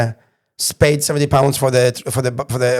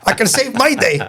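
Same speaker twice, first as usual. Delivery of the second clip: high, worked-up self-justification; high-pitched, indignant retold argument; animated protest; 8 semitones higher than usual. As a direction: plead your case in a raised pitch. Acted argument on the train.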